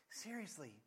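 ordinary talk, nothing marked

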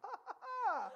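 high, forced ha-ha-ha; strained, separated high laughs; mock laugh. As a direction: fake a laugh in exasperation. Retold fake laugh at kid's excuse.

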